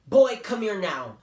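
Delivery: mother summoning her son, sharp and slow; angry mom calling her boy back; stern mother's command. Retold or imitated. Mother voiced ordering son.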